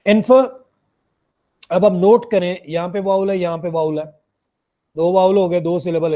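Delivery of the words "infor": "infer" as IN-fuh, no r, rising; syllable breakdown. Shown as a demonstration. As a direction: isolate "infer" with a weak schwa ending.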